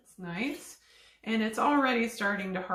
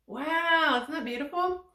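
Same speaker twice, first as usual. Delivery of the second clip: higher, delighted gasp of wonder; delighted wow; 6 semitones higher than usual. Real, not acted. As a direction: marvel at it. Seeing candy form on snow.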